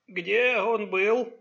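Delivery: high, very drawn-out question; old man. A narrator's reading. Grandfather asks his son about the boy, old man's drawl. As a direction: stretch the words slowly, like an elder.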